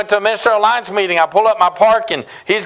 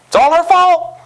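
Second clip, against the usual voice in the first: laughing joke; 8 semitones higher, 4 dB louder than usual. Real, not acted. Joking blame with laugh.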